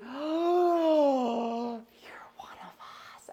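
high gasp, then a hushed whisper; imitating Wendy. Imitating Wendy whispering that she's one of them. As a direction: welcome her in like a secret club.

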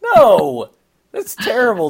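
high laughing protest. Real, not acted. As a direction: laugh through mock outrage. Laughing outrage at Wahlberg joke.

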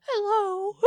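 high, cracked teary hello; awkward teary hello. Retold or imitated. Caught crying by customer, high teary hello. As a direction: greet the intruder while caught crying. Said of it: "I'm like".

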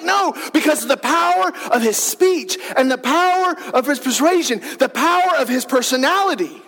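full-force preaching climax; fiery preaching. Acted. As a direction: build the crowd up like a revival preacher.

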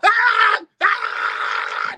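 high piercing scream of shock, then a breathy cry; retold anguished scream. Screaming on hearing his friend died. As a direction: let out a raw scream at the news.

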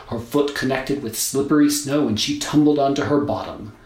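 usual speaking voice

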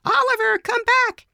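put-on very high, loud kid-like holler; children shouting. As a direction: shout after a runaway dog like excited children. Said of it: "three children running down the street shouting".

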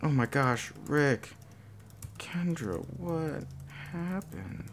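soft, broken-up self-dictation; hushed, halting read-back of a draft; quiet dictation. Real, not acted. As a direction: murmur it in pieces while typing. Typing out the agreed line.

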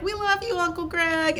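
high-pitched imitation of cute kids; sweet, high retold kid voice; kids' chorus. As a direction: mimic little kids calling to their uncle. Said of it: "all the kids at the end are like".

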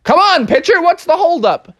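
high, impatient call; impatient umpire. Acted. Umpire hurrying Froggy, high and impatient. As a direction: hurry the pitcher.

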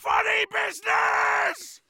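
high, loud shouted charge; pirate battle cry. Acted. Pirate descending on the ceremony, battle cry. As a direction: holler it to start the raid.

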